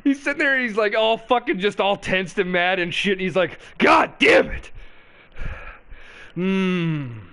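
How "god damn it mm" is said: mock angry Joe. Host mimics Joe cursing, then sipping coffee. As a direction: mimic a tense ranter cooling off with coffee. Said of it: "listen to how weird Joe gets when he's drinking the coffee".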